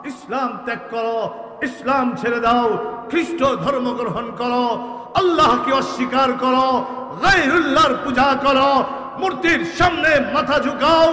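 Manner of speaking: from plain to high and loud commands; building demands. Acted. King ordering the companion to renounce faith, rising anger. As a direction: bark each order harder. King's demands voiced in story.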